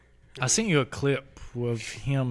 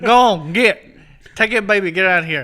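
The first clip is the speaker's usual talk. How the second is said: loud shooing; 5 semitones higher, 10 dB louder than usual. Retold or imitated. Quoting Baby Billy shooing crowd.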